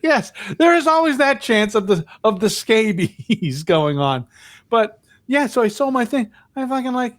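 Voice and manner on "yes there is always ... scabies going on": high-pitched start breaking into a laugh; amused. Real, not acted. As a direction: play along, tickled by the word. Playing along with scabies joke.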